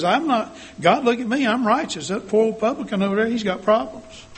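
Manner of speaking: high-pitched mock self-righteousness; hammed-up Pharisee looking down on others; self-righteous Pharisee. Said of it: "I'm sort of like the Pharisee who says".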